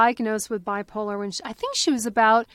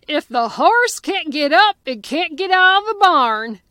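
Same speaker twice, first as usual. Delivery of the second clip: light imitation of a Southern Kentucky grandpa, louder and higher; Southern Kentucky accent; 5 semitones higher, 7 dB louder than usual. Retelling the grandfather's line to his granddaughter, Southern Kentucky accent. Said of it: "in his deep Southern Kentucky accent".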